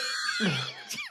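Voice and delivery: a high breathless burst of laughter; laughing. Real, not acted. Host laughing at the terrible accent. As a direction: crack up at the bit. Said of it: "This is truly terrible".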